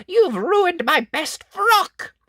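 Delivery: very high, loud, drawn-out screech; shrieking witch. Acted. Witch whose frock is ruined, furious shriek. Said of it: "shrieked the witch".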